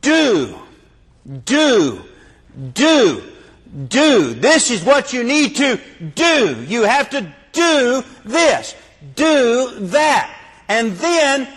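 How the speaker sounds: high, sing-song nagging voice, lightly mocking; hammed-up mimic of a works preacher; mock legalist preacher. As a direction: parody a busy preacher piling on duties.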